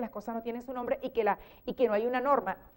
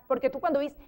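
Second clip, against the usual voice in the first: ordinary delivery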